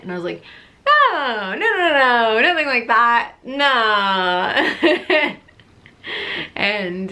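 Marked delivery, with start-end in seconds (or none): 0.9-5.4 high, loud, rushed no no no; retold flustered denial, half laughing; panicked denial.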